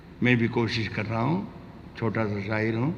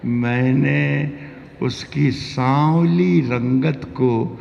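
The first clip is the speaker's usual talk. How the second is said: high, slow declaimed verse; sing-song the line to the crowd; ghazal recitation; 5 semitones higher, 6 dB louder, slower than usual. Acted.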